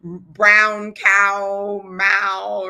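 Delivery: "Brown cow" said loud and drawn out as a mouth warm-up; warm-up drill. Shown as a demonstration. Coach showing a voice warm-up, loud drill. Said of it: "Do simple warm-ups".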